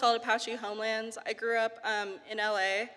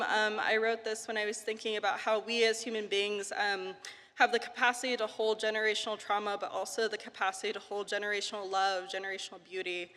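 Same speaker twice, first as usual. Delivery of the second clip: nervous open mic poet. Real, not acted. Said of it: "rushed explanation".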